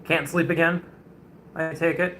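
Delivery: ordinary delivery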